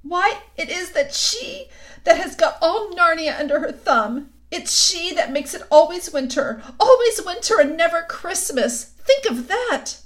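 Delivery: high, loud wailing lament; tearful outcry. A narrator's reading. The faun tells Lucy of the witch, wailing. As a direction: cry out in misery.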